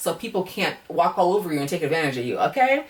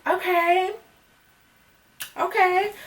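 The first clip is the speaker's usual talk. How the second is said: high, drawn-out doubtful okays; skeptical okay; 11 semitones higher, much slower than usual. Retold or imitated. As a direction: pull back in disbelief. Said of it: "I was just like".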